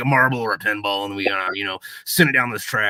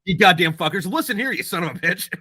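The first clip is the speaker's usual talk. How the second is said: louder, higher hammed-up cussing; mock cussing out; 6 semitones higher, 4 dB louder than usual. Joking on a podcast, cussing out toll-lane bureaucrats, hammed-up angry yell. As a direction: play-act telling off the bureaucrats.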